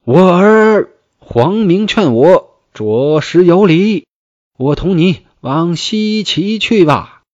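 high, loud, slow cry after his sons; old general bellowing across the field; calling out loud. Acted. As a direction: shout after the fleeing sons, drawn out. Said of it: "大叫".

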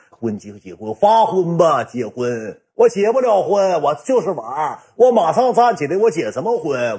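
drawn-out, swinging high bluster; retold boastful scoffing, slow and dismissive; cocky showing off. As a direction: stretch the words out like a big shot. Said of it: "当时我也是装逼".

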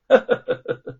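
high, cold villain's laugh; sinister cackle. A narrator's reading. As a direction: cackle with cruel glee. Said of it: "严嵩阴冷一笑". (阴冷的奸笑)

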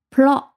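"pro" said with a short O as in ox, lifted and clear. Shown as a demonstration. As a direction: clip the O in "pro" like ox. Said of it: "这个O发成O短音". (短元音的pro)

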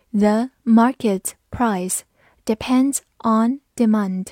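slow, word-by-word teaching read. Shown as a demonstration. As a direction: read it slowly with clear gaps between words. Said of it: "好，慢读一遍". (一词一顿地慢读)

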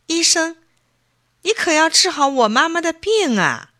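put-on high, urgent boy's pleading; anxious plea. Boy begging the doctor to save his mother, high and anxious. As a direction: beg the doctor like a worried child. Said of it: "着急地说".

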